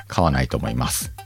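ordinary delivery

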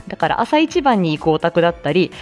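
normal talking voice

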